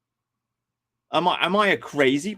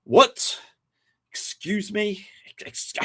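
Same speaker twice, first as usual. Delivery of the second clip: incredulous; 5 semitones higher, slower than usual. Retold or imitated. Streamer recalling hearing Morgoth, rising outraged disbelief. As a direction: sputter in mock shock. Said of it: "and I'm like".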